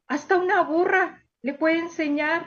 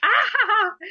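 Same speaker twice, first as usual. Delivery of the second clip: hearty laugh; 7 semitones higher than usual. Acted. Teacher laughs at the clever donkey.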